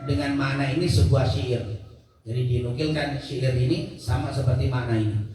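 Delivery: usual speaking voice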